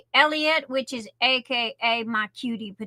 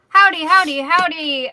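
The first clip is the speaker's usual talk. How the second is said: belted howdy, louder; loud sing-song greeting; 3 semitones higher, 7 dB louder than usual. Real, not acted. Host belting hello to her viewers, loud and sing-song. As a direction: call out the greeting big and bright.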